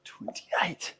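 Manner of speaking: breathless whispered counting. Real, not acted. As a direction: whisper the count through effort. Counting reps under heavy load.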